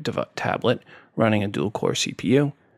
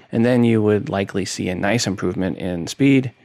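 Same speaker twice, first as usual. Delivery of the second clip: normal talking voice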